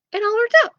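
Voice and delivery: high, smug, rising repeat. Acted. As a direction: say it like you planned it.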